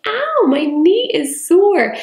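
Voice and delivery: high, slow, hammed-up ow; animated pretend pain. Shown as a demonstration. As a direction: overplay a bumped knee so the child copies. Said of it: "be really quite animated and pretend to bump".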